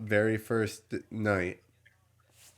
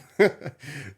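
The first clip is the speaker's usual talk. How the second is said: loud high laugh; 7 semitones higher, 6 dB louder than usual. Real, not acted. Laughing at paramedics leaving.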